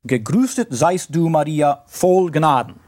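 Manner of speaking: Gegrüßet seist du Maria voll Gnaden in German pronunciation; German words read slowly; German phrase. Shown as a demonstration. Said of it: "it's like that in the German".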